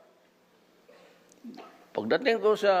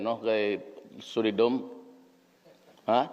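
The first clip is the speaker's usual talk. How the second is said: ordinary talk, nothing marked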